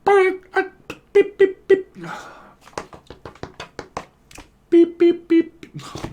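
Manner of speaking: very high electronic beeping; squeaky mimicked dial tone; phone beeps. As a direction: beep like a dead line. Imitates phone failing to connect.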